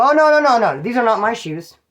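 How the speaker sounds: high, loud string of denials, easing off; emphatic protest. Retold or imitated. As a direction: protest firmly, hitting the first "no" hard. Acted protest about the shoes.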